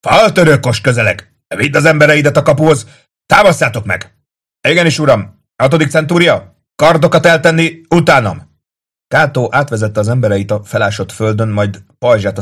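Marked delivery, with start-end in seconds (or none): 0.1-4.2 high, loud warning shout; shouted orders. A narrator's reading. Tullius to Kato as a ram nears, shouting. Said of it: "kiáltotta Tullius a kapu fölötti gyilokjáróról". 4.7-8.5 high, loud military bark; rally the troops with a shout; shouted command. A narrator's reading. Kato to his century, shouting orders.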